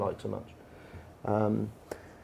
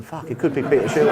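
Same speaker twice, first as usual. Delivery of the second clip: laughing panic; 5 semitones higher, 4 dB louder than usual. Retold or imitated. Panic realising it's really him.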